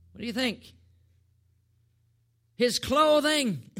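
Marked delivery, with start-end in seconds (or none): 2.6-3.7 high, loud, drawn-out answer; emphatic exclamation. Real, not acted. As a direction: announce it big and slowly. Answer to his question, exclaimed.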